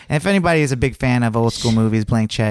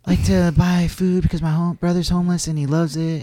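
mimicking an annoyingly soothing talker; put-on soothing, slightly smoky woman's voice; Crystal's soothing voice; 5 semitones higher than usual. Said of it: "she talks like this".

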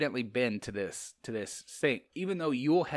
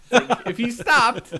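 laughing mock-dramatic reading, higher and louder; 11 semitones higher, 12 dB louder, slower than usual. Mocking a Dan Brown line, laughing. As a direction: giggle through the cheesy line.

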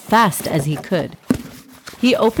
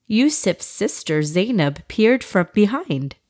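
ordinary delivery, nothing marked

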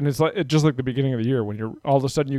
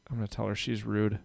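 quiet aside; 4 semitones lower, 10 dB quieter than usual. Real, not acted. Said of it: "I'm in the middle of a podcast".

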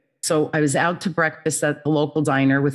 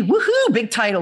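mock cheer; 5 semitones higher than usual. Real, not acted. Mocking her big job title.

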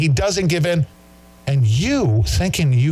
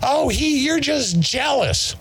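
high, slow mocking whine; high-pitched mock of the critics; mocking whiny quote; 14 semitones higher, slower than usual. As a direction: mimic the critics in a high sneer. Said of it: "And they're like".

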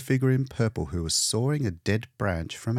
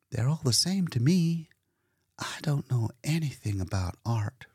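higher, lightly voiced young woman, trailing into a whisper; Leora's voice; 6 semitones higher than usual. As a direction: play her as an unsure hostess, dropping to a hush. Leora speaking to painter.

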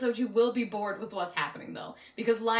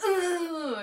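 higher grunt breaking into a laugh; laughing mock grunt; 7 semitones higher than usual. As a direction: grunt it while cracking up. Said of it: "getting his hair pulled".

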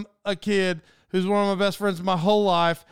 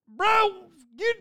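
exasperated; 12 semitones higher, 3 dB louder than usual. Retold or imitated. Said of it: "So I'm like".

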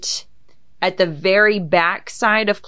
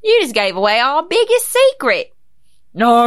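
high cartoon Cockney accent; a playful go at a Cockney accent; Pinky Cockney accent; 7 semitones higher, 5 dB louder than usual. Shown as a demonstration. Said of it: "'Cause Pinky speaks in a British accent".

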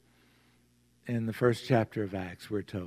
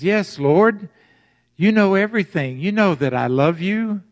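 higher, louder exasperated plea; frustrated Peter insisting he loves Jesus; 7 semitones higher, 8 dB louder than usual. Acted. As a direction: voice Peter fed up at the third question. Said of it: "Peter's kind of frustrated right now".

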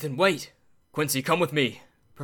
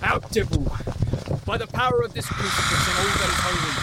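commanding shout; 5 semitones higher than usual. Acted. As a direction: banish him with full force. Warding Dracula off with a crucifix.

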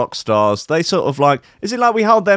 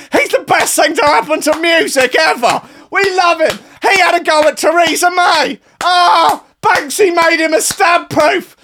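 gushing fan parody; 4 dB louder than usual. Retold or imitated. Mocking fans who worship [name], in a loud high gushing voice. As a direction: shriek his praises like a swooning fan, over the top. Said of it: "everyone's like".

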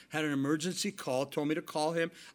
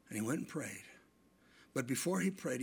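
ordinary talk, nothing marked

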